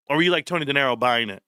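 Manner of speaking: ordinary talk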